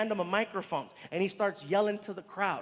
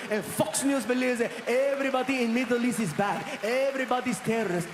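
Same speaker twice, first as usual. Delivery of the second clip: Saudi man. Retold or imitated. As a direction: plead in a lightly done Arabic accent. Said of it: "He walks up to me".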